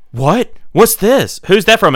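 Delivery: rising, amazed questions; surprised gasp. Retold or imitated. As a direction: act out her delighted surprise.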